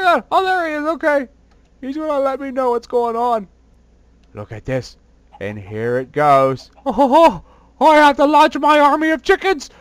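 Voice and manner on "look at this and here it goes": low, slow plotting villain voice; deep scheming villain. Acted. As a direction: growl out the plan like a cartoon baddie. Deep voice watching the attack.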